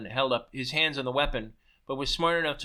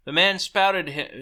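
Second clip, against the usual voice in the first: plain reading voice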